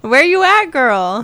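exaggerated movie-line impression; imitated cop's drawl. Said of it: "And he says".

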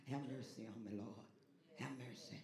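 low, soft murmured plea; old folk's everyday prayer. Retold or imitated. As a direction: say it quietly to yourself around the house. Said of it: "Old folk used to just say it around the house".

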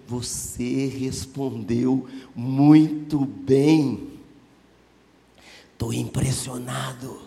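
slow, deliberate praise; measured, understated admiration; Jesus. Acted. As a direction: draw the compliment out word by word. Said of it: "Jesus falou assim para ele".

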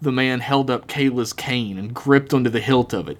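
plain reading voice

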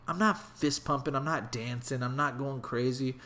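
normal talking voice

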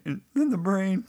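creaky, cracking question; vocal fry. Real, not acted. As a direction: let the voice crack up high. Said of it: "you can delete the voice vocal fry".

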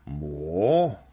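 loud, rising disbelief in own voice; loud, drawn-out questioning echo; incredulous question. Acted. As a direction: query it like a stern owner. Answers the begging dog voice.

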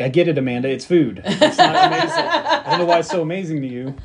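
fake-bored complaint through laughs; exasperated mock rant. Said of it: "I'm like".

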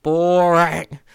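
sudden loud groaning boring; exasperated shout. Real, not acted. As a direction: bellow the word boring out of frustration. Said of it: "'cause it's so".

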